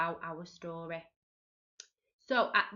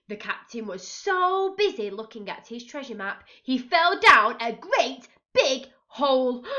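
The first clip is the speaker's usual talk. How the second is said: ordinary delivery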